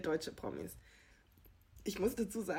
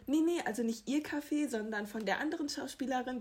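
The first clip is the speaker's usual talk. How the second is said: re-enacted hasty correction, higher pitched; higher, mock-flustered denial; flustered backpedalling; 5 semitones higher than usual. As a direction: act out scrambling out of a blunder. Acts out her flustered backpedalling.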